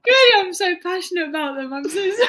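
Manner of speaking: real laughter through high, loud words; giggling, high-pitched embarrassed confession; laughing apology. Real, not acted. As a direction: gush it helplessly through laughter. Laughing at own random answer.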